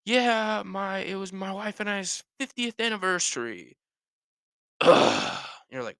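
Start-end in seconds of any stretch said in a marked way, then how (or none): 0.1-5.7 high, strained, drawn-out answer with a grunt after; hammed-up achy grunter; grunt at sentence end. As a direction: act in pain over happy news. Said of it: "there's always, like, a grunt".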